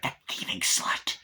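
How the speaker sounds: slow whispered sneer; muttered insult. Retold or imitated. Tiffany resenting the girl wearing that ring, hushed contempt. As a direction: whisper it slowly, acting out the insult.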